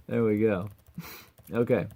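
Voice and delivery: ordinary talk, nothing marked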